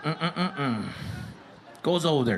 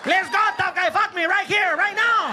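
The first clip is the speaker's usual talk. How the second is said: much higher, loud macho challenge; dad shouting; 14 semitones higher, 5 dB louder than usual. Retold or imitated. Dad challenging the ref to a fight, high and loud. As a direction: bellow a dare at the tough guy.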